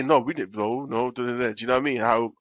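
ordinary talk

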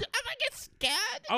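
very high, slow, eerie creature voice; a creepy high ghostly drawl; full spooky monster voice; scary voice. Shown as a demonstration. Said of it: "That was a scary voice".

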